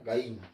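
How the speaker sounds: "Gallina." as a clipped "gaín"; Lima pronunciation. Shown as a demonstration. Teacher repeating the Lima sound for the board. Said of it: "estoy indicando que es el sonido".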